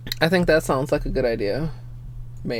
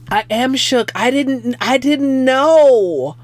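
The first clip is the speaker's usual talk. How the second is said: high, loud, slow drawn-out disbelief; stunned; 5 semitones higher, 4 dB louder, much slower than usual. Real, not acted. Streamer stunned by what she just saw, slow and high. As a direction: stretch it out in amazement.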